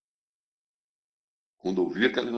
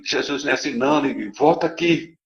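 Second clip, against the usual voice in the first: calling out; 5 semitones higher, 5 dB louder than usual. Retold or imitated. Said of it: "começou a gritar pra ele".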